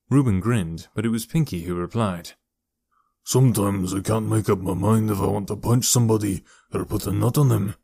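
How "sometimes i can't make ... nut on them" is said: throaty thug with a Scottish lilt; deep, throaty Scottish. A narrator's reading. Pinky explains his tattoo, throaty Scottish voice. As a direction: speak from the throat with a hint of Scottish. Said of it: "His voice was deep and throaty, with a hint of a Scottish accent".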